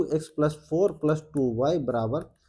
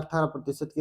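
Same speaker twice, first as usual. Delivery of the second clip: normal talking voice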